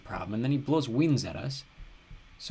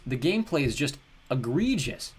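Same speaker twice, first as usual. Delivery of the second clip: usual speaking voice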